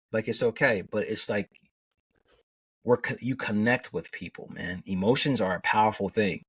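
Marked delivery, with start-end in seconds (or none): none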